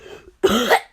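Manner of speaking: sharp cough to clear the throat. Real, not acted. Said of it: "I know how to get my normal voice back".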